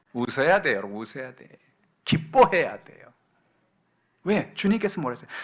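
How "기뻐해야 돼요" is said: ordinary delivery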